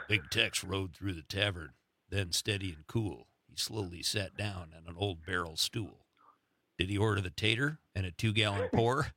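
low cowboy storyteller drawing out a verse; low-pitched amateur Western impression; Sam Elliott. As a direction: read it like a deep, steady Western narrator. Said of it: "I'll do my best Sam Elliott".

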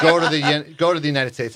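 teasing jeer. Retold or imitated. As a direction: imitate friends egging him on through laughter. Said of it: "his friends always made fun of him".

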